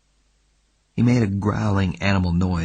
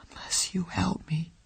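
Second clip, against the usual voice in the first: whisper; 9 semitones higher, 9 dB quieter than usual. Acted. Frightened plea after shivering.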